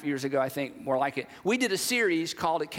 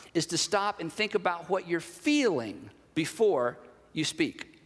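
measured delivery with weight on "feeling" and a drop on "speak"; slow, deliberate emphasis; slower than usual. Real, not acted. Preacher laying out the first step of the exercise, slow and deliberate. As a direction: spell it out slowly, pausing before "you speak". Key step spelled out slowly.

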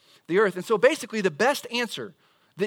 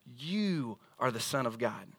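lower, softer, slow You are the Son of God; slow and weighty; 6 semitones lower, 7 dB quieter, slower than usual. Shown as a demonstration. Preacher repeats the claim to the congregation, weighty. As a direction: let each word land. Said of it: "exclusive statement".

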